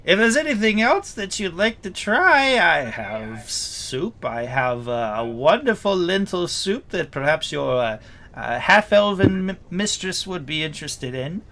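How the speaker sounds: high, loud gnome cook voice. Acted. Gnome cook offering soup to guests, in character. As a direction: pitch it up, lingering over the offer of soup. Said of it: "and he says".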